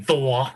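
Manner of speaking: "Thor" in mom's way; imitating mom's "Thor". Son mimics mom naming a superhero, imitated "Thor". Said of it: "you got my mom saying".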